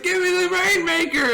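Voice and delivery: high-pitched mock holler; imitated drunken demand. Drunk friend demanding the gun.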